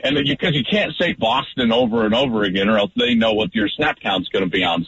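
ordinary talk

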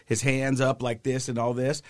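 normal talking voice

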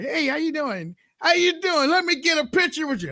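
high, eager starstruck greeting. Retold or imitated. Podcaster meeting Governor Perry, high and starstruck. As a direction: sound thrilled to meet the governor.

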